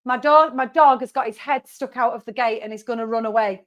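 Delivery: ordinary talk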